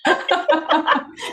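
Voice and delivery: a big real burst of laughter; loud laugh. Real, not acted. Laughs at emotional moment.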